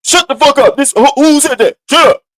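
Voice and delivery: imitating women shouting him down; mock angry yell. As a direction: yell it like a fed-up woman. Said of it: "when I see women do".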